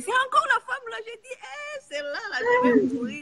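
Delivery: high, squealed re-enactment; excited mock quote. Caller retells colleagues spotting the star, mock-excited squeal. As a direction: act out the exchange in a high, giggly squeal. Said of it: "on me dit".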